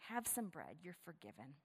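soft closing blessing; hushed. Shown as a demonstration. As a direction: let it fall to a hush.

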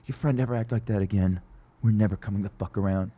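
low, quiet menacing threat; whispered anger. Retold or imitated. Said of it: "his whisper a-, anger is the best".

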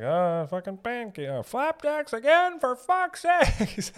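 very high, loud, drawn-out complaint; hammed-up grumbler screeching over breakfast; exasperated resident. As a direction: complain way up high, stretching the last words. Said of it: "There's gotta be a guy like that".

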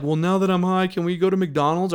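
a hint of goofy stoned pleading; stoned kid. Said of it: "I said something stupid too".

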